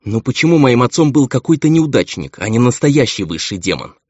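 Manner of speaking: loud, high-pitched protest of despair. A narrator's reading. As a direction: cry out in bitter frustration. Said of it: "с отчаянием думал Эмил".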